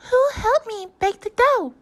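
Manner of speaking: high, loud hen voice asking; little red hen asking. A narrator's reading. Hen asking the farm animals for help, high and loud. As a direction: pitch it way up like a busy hen. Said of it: "she asked".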